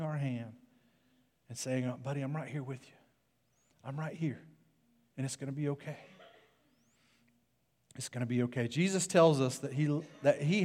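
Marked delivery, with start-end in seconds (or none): 1.9-8.7 very soft, soothing voice; tender reassurance. Acted. God holding our hand, soft tender reassurance. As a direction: comfort them gently, almost under the breath.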